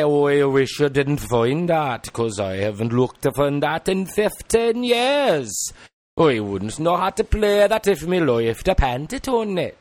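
rueful Welsh parent. Acted. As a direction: sigh it like a Welsh mum. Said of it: "Welsh dialect".

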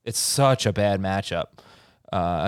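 usual speaking voice

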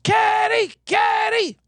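high shouted cheer of a casino crowd. Retold or imitated. Crowd at the table cheering Kenny, shouted. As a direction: yell his name like the cheering crowd. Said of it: "It's like".

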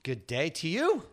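slow mock-British greeting; bad British accent. Host greets cohost playfully, badly faked British accent. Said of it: "Your accent is".

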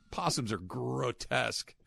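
slow, quiet, creepy possum voice; nervous sleepy possum. Pretend. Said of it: "That was a good possum impression by me".

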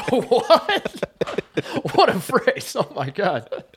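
high, stunned "What?" breaking into a laugh; stunned disbelief. Retold or imitated. Retelling his shock at a coach's odd phrase, high stunned disbelief. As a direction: gasp it out, floored by the phrase. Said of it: "I was like".

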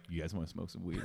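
low, hushed laid-back professor with a laugh; cool professor. Acted. Cool professor slyly offering students a smoke, low and quiet. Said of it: "He's like the professor who sits in the chair backwards".

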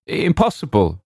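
high-pitched objection; protesting call. A narrator's reading. Visitor outside the door objects, high protesting call.

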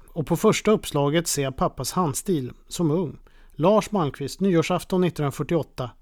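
ordinary talk

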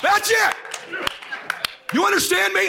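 high, forceful shouted pep talk; fired-up dad yelling. Retold or imitated. Dad rallying his young son, fired-up yelling. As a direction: yell it to rally him.

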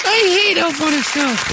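very high, hammed-up kid whine; wailing child. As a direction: wail it like a miserable small child. Child tormented by the elf.

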